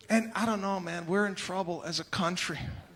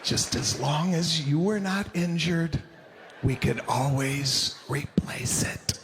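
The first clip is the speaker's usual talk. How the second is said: forgiving mother; 5 semitones lower than usual. Retold or imitated. The forgiving mother comforts the child about the broken bike, gentle and hushed. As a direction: soothe the kid, almost a whisper. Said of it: "a mother that was more forgiving".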